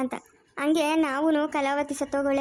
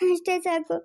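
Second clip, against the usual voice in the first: begging between sobs; crying; 2 semitones higher than usual. Acted. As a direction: weep and plead at her feet. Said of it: "ಸರಿ ಬಿಡು, ಅಳ್ಬೇಡ".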